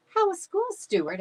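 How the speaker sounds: high, bright motherly question; a mother checking in at dinner; mom voice. A narrator's reading. Mom asking Stuart about school, high voiced.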